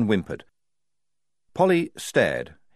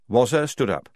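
usual speaking voice

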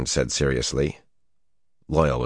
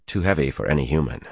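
ordinary delivery, nothing marked

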